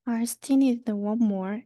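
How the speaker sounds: usual speaking voice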